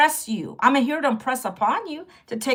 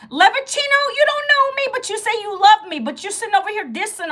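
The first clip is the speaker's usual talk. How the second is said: pitched way up, confronting a viewer; high-pitched call-out; 8 semitones higher than usual. Real, not acted. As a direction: call them out, high and sharp. Calling out a viewer.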